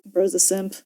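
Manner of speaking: usual speaking voice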